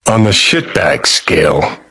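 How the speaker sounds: ordinary talk, nothing marked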